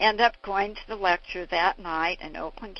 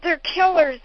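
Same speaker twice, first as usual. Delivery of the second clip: repeated fiery warning; 9 semitones higher, 7 dB louder than usual. Retold or imitated. Father repeating his warning to the hall, loud. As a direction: say it again to drive it in. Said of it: "He said".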